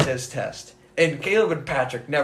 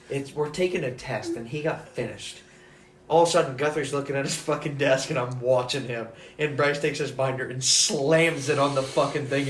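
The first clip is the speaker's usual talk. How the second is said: ordinary delivery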